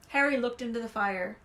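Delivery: usual speaking voice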